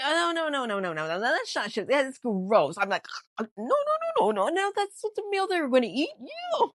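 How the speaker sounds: loud, high stream of horrified noes; disgusted protest. Real, not acted. Podcaster objecting to the rat meal, disgusted protest. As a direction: recoil with a high, rising outcry. Recoiling from a gross cooked-rat story.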